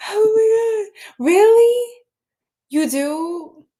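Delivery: a thrilled squeal of disbelief; delighted surprise. Real, not acted. Streamer thrilled by a viewer's generous offer, squealing.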